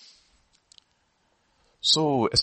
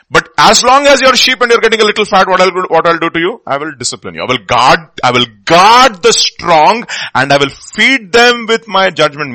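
loud, high, slowed preaching; 5 semitones higher, 9 dB louder than usual. Acted. Pastor warning fattened sheep in church, loud. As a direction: drive it home to the flock.

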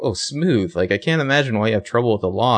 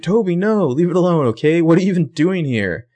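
higher, louder and desperate; frantic pleading; 8 semitones higher, 4 dB louder than usual. Retold or imitated. Spencer pleading with Toby during the arrest, high frantic begging. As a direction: beg him to drop it, voice climbing.

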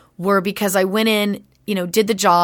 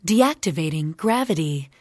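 lightly robotic computer voice; a hint of a calm ship computer; 3 semitones lower than usual. Shown as a demonstration. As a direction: speak like a ship's computer. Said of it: "in the trailer they have me say".

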